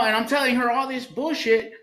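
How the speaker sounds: normal talking voice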